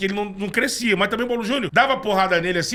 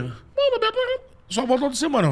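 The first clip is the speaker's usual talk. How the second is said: much higher complaining boss; 6 semitones higher than usual. Retold or imitated. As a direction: whine it like the boss. Boss Wagner voiced on phone.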